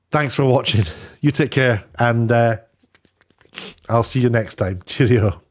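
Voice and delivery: ordinary talk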